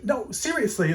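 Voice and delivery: high-pitched retold protest; earnest insisting. Speaker retelling the magpie warning to a doubter, insistent.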